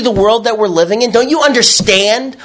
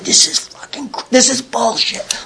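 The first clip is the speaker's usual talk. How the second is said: bitter, hushed swearing under the breath; a quiet, furious grumble; angry mutter; 4 semitones higher, 5 dB quieter than usual. Retold or imitated. Fuming at normal poolside families.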